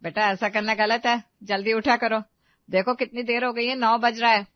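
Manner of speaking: loud mother-in-law's reprimand; disapproving scolding. Acted. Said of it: "तो चेहरे का रंग बदल गया".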